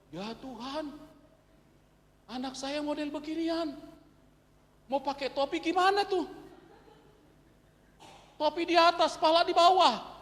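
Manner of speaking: new father moans to God about the oblong head, high and loud; complaining to God.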